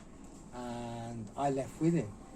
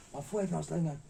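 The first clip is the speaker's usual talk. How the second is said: usual speaking voice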